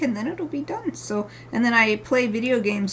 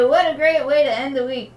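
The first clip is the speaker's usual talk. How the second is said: normal talking voice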